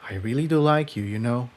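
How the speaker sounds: sincere quiet admission; Jigsaw, tender. Acted.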